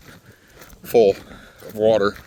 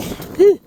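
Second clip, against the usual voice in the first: breathless whew; 6 dB louder than usual. Real, not acted. Ran up the hill.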